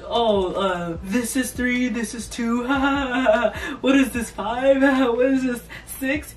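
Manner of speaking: hammed-up laughing through the words; imitated mocking laughter. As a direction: crack up while counting, mimicking a teacher. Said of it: "she started busting out laughing".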